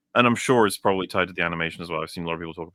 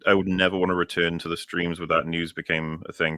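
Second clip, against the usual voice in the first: usual speaking voice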